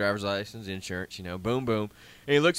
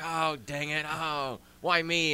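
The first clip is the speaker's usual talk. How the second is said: slow, higher mock whine of self-pity; 4 semitones higher, 3 dB quieter, slower than usual. A driver seeing police lights, mock whining. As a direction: drag out a whiny why me. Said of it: "It's just like".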